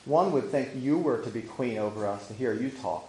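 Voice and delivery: ordinary delivery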